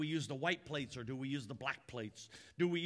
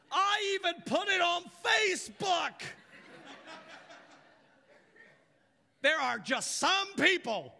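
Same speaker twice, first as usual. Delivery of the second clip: high loud order; demanding Martha; 12 semitones higher, 7 dB louder than usual. Acted. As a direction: bark it at Jesus like a spoiled sister.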